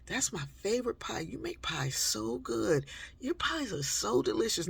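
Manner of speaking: a hint of a kid buttering up his mother; brother sweet-talking. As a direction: brother coaxing Mom for pie, light sugary flattery. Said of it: "maybe my brother would say".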